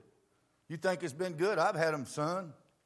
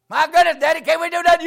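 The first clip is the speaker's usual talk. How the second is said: put-on high and loud, a whining kid begging his daddy; pleading boy; 12 semitones higher, 13 dB louder, faster than usual. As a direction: beg Daddy like a nineteen-year-old kid.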